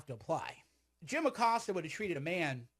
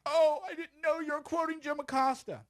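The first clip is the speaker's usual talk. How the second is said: high, put-on voice realising it was a quote; mock viewer; 11 semitones higher, 4 dB louder than usual. Mocking a viewer who learns he was quoting, high and sheepish. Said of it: "And you'd be like".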